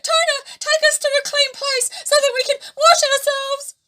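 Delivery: high, loud, urgent panic; panicked plea. A narrator's reading. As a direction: beg frantically. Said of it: "Tuna panicked".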